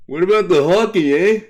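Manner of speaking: loud, high mock Canadian accent with a tagged-on eh; attempt at Canadian accent. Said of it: "it was an attempt at a Canadian accent".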